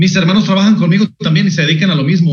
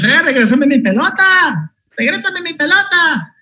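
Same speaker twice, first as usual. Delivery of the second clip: put-on high, drawn-out childish yelling; kids yelling from rooftop; 11 semitones higher, slower than usual. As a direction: yell it across the rooftops like a kid. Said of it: "gritándole a los niños".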